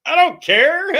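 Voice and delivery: very high, loud put-on shriek; squealed brush-off; mock dismissive yell. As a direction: fling it back with exaggerated indifference. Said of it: "And I was like".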